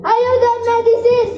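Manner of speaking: earnest school declamation; poem recitation. Acted. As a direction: recite it proudly to classmates.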